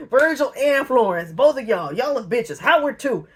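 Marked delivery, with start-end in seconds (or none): none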